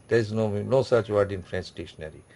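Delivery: usual speaking voice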